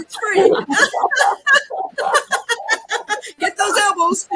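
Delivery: high, loud bursts of laughter between the words; hearty demo laughter. Shown as a demonstration. Laughter yoga leader demoing laughter cream, loud high laughing. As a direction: laugh it up big to get the group laughing. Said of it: "Okay, here we go".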